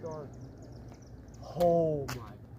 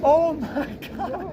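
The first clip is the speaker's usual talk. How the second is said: joyful cry; 8 semitones higher, 8 dB louder, much slower than usual. Real, not acted. Awe at totality.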